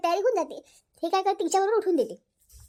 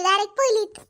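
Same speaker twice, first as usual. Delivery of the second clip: usual speaking voice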